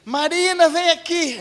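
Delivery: high imitated call to Marina; mock yell. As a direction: call out in a high voice, neighbor style. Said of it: "ela começou a gritar lá". (imitando o grito da vizinha, agudo)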